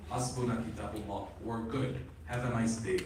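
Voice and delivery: low, soft, offhand brush-off; casual mock sign-off, dropped right down; mock dismissal. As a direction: toss it away quietly and low. Voices those dismissing the family.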